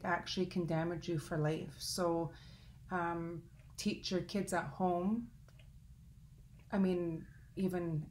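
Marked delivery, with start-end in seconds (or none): none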